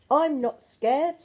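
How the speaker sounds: slow, loud declaration of courage; brave child. Acted. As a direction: puff up to sound brave. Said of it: "said the Gruffalo child".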